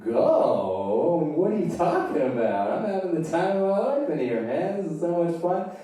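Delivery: high-pitched, baffled refusal; incredulous protest. Acted. Teenager having the time of his life behind the door, incredulous protest. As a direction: protest high, as if they must be crazy. Blissful teen refuses to leave room.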